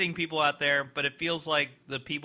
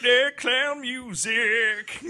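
high, slow sing-song clown voice; silly clown; 12 semitones higher, 5 dB louder, much slower than usual. Retold or imitated. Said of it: "They're like".

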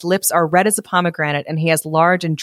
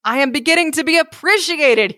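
excited cry; 9 semitones higher than usual. A narrator's reading. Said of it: "he cried".

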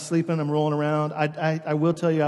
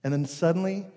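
ordinary delivery

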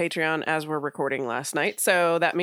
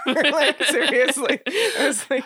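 laughing; 8 semitones higher, 4 dB louder than usual. Real, not acted. Laughing at LA prices.

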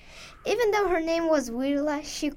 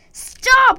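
loud, high shout of stop; scream; 11 semitones higher, 13 dB louder than usual. Acted. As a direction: yell it at the voice. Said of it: "Wirla screamed to the weird voice".

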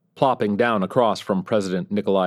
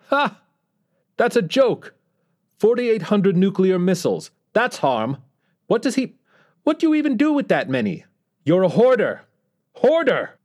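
much higher mocking dictator's whine with a Korean accent; high-pitched whine; 13 semitones higher, 4 dB louder than usual. North Korean leader mocking the US president, high Korean-accented whine. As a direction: sneer and jeer in a shrill Korean-accented whine. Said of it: "that high-pitched whine".

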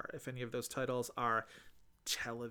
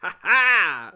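a much louder, higher gloating laugh; triumphant ha ha; 5 semitones higher, 14 dB louder than usual. Real, not acted. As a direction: crow over the win. Winning a guess in the game.

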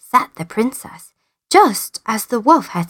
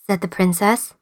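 ordinary delivery

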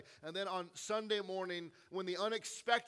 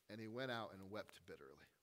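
somber; 10 semitones lower, 10 dB quieter than usual. A narrator's reading. Somber retelling of Peter's failure.

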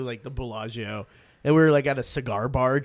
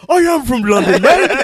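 put-on higher, louder London accent through a laugh; 9 semitones higher, 9 dB louder than usual. Londoner answering the New York girls, laughing London accent. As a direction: play the cheeky Londoner. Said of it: "You sound like you're from London".

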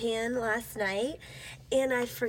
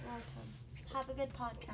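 much softer, trailing goodbye; soft farewell; 13 dB quieter than usual. Real, not acted. Roommate signs off to host's listeners, soft. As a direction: murmur it as you leave.

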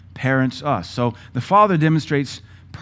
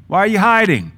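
much higher, louder call for Adam; God calling out; 8 semitones higher, 7 dB louder than usual. Retold or imitated. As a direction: call across the garden to find him.

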